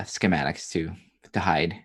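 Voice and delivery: ordinary delivery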